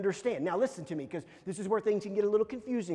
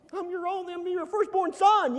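put-on high-pitched childish protest; upset kid; 10 semitones higher, 7 dB louder than usual. Upset son to his parents, high mock protest. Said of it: "I'm upset about this".

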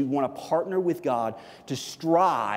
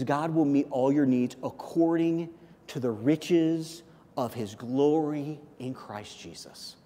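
slow full verse reading; 2 semitones lower, 3 dB quieter, slower than usual. Shown as a demonstration. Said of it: "look at the entirety of the verse".

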